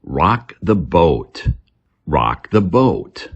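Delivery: each word said slowly and clearly; slow it down so learners can copy; slow model reading. Shown as a demonstration. Teacher models the phrase for learners, slow and clear. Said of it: "Common English expression".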